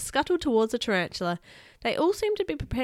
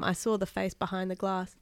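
ordinary delivery, nothing marked

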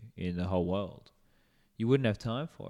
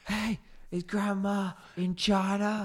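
high put-on voice; 10 semitones higher, slower than usual. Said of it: "he goes".

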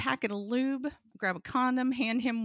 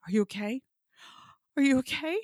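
each question higher than the last; acted-out nervous concern, climbing; anxious repeated check-in; 5 semitones higher, 3 dB louder, faster than usual. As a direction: fret with rising pitch on every repeat. Repeated check-ins climbing in pitch.

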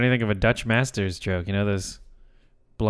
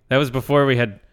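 ordinary delivery

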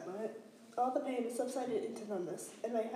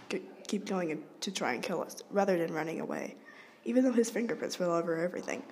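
plain reading voice

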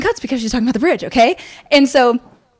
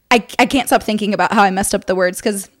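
ordinary talk, nothing marked

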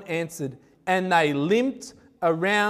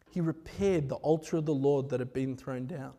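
softer, lower, reflective repeat of "He repaired the altar"; quiet repeat; 5 semitones lower, 8 dB quieter than usual. Acted. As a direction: say it again quietly, letting it sink in. Same line repeated for weight.

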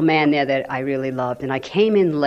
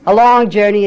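"long" pushed higher and much louder; stress on long; 5 semitones higher, 10 dB louder than usual. Real, not acted. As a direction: lean hard on "long" in "a long journey". Repeats the phrase for emphasis.

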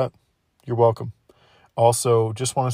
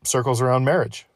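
ordinary delivery

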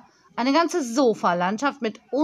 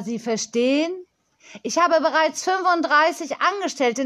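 put-on proud girl, key words stretched; boastful young girl; 4 semitones higher, 4 dB louder than usual. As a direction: brag like a tiny company boss.

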